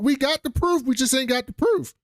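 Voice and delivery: high-pitched flustered retelling; mock high squeak. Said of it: "He was like".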